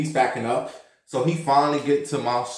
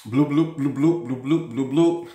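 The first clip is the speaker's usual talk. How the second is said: toilet plop noises. Retold or imitated. On the toilet telling viewers, mock plopping noises. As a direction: pop your lips like drops falling in water. Imitating toilet sounds in stall.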